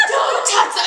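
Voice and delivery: husband guarding the turkey; panicked command. Retold or imitated. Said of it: "He's like".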